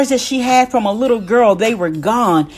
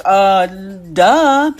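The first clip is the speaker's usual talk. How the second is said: louder, drawn-out "uh, duh"; retold sassy duh; 5 dB louder than usual. Daughter answers mom's question about his speed, retold sassy duh. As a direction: imitate a daughter stating the obvious.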